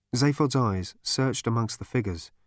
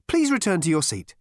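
metallic voice; 8 semitones higher, 4 dB louder than usual. A narrator's reading. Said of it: "repeated the autopilot".

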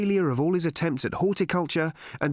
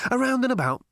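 high, airy evasion; infuriatingly breezy; 11 semitones higher, 3 dB louder, faster than usual. A narrator's reading. Ford dodges Arthur's question, high and infuriatingly breezy. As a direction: toss it off lightly, pitched high. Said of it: "judged to be an infuriating manner".

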